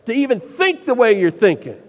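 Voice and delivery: loud, commanding preacher's rebuke; forceful scolding order to the crowd; firm refusal. Acted. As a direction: lay down the law, punching the NO. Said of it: "He should have said".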